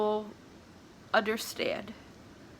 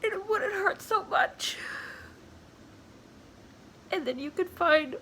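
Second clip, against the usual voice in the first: higher voice, halting through real tears; crying; 5 semitones higher than usual. Real, not acted. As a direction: talk through real crying, pausing to get the words out. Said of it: "I'm sorry for crying".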